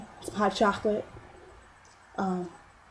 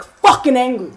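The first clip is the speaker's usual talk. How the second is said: sudden anger; 4 semitones higher, 10 dB louder than usual. Retold or imitated. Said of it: "I went from".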